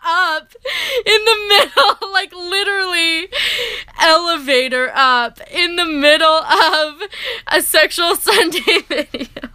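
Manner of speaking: high, laughing through words; laughing disbelief. Retold or imitated. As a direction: crack up while quoting. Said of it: "he was like".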